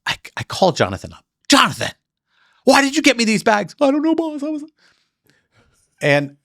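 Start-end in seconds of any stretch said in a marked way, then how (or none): 1.5-3.8 much higher, louder, chewing him out; retold angry outburst. Yelling at Jonathan, retold. 3.8-4.6 much higher timid voice playing Jonathan; meek employee. Voicing Jonathan's meek answer.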